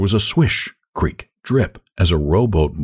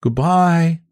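high, lilting farewell; much higher feminine character voice; 9 semitones higher than usual. A narrator's reading. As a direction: call goodbye in a girlish voice. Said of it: "said the voice".

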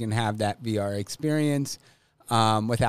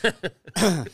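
harsh cough from spicy food; coughing on the hot popcorn; coughing fit; 6 semitones higher than usual. Real, not acted. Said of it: "That's that fire".

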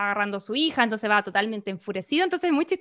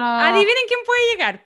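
higher, louder mock announcer; teasing announcer voice; 9 semitones higher, 5 dB louder than usual. Host imitating the film's surprise voice, higher teasing call. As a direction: tease the twist like a showman. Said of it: "se escucha una voz que dice como".

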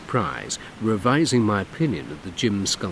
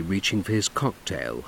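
ordinary delivery

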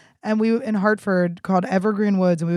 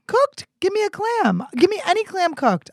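excited; 11 semitones higher than usual. Real, not acted. Excited outburst about clams.

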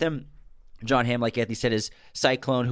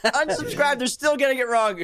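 mock angry listener; 13 semitones higher than usual.

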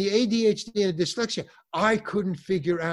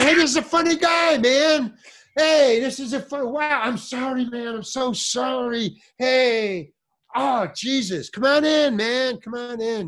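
much higher, louder, effusive apology; overjoyed gushing welcome; 8 semitones higher, 3 dB louder than usual. Retold or imitated. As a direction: fawn over him as a star. Dealer turns apologetic and welcoming.

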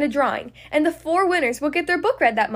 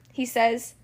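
usual speaking voice

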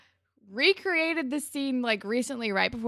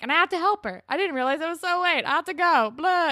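higher, louder mock flustered excuse; 6 semitones higher, 5 dB louder, faster than usual. Retelling Marco's bald-faced lie, mock flustered. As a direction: rush out a transparent mock excuse.

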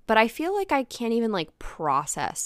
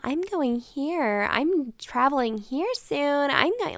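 higher, drawn-out mimicking voice; mimicked chatter; 7 semitones higher, slower than usual. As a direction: imitate someone going on and on. Retold excited chatter, mimicked.

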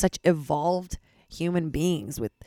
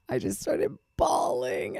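crying; 9 semitones higher, 4 dB quieter, slower than usual. Real, not acted.

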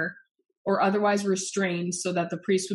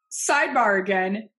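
ordinary talk

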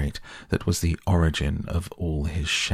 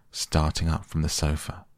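ordinary delivery, nothing marked

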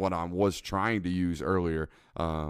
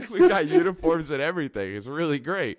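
much higher and louder, cracking up while quoting; laughing repeat; 7 semitones higher, 5 dB louder than usual. Retold or imitated. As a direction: repeat the line through laughter.

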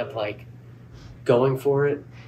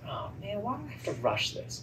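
higher, soft self-reproach; frustrated groan; 9 semitones higher, 9 dB quieter than usual. Retold or imitated. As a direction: moan it quietly to yourself, annoyed. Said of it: "I said".

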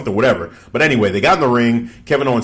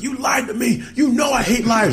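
much higher, shouted accusation; mock furious Daniel Bryan; 13 semitones higher than usual. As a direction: scream betrayal at him. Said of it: "He's like".

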